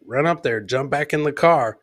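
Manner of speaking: usual speaking voice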